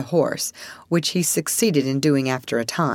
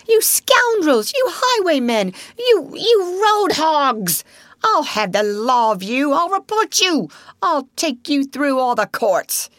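furious shouting; 10 semitones higher, 3 dB louder than usual. A narrator's reading. Rat raging at the vanished motor car, shouting. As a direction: rage at the road hogs. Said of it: "he shouted".

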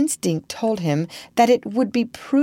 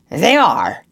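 louder pirate snarl; villain snarling his reply, louder; 8 dB louder than usual. A narrator's reading. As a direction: spit the answer back at her. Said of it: "he snarled".

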